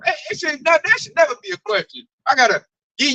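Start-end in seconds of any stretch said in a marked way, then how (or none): none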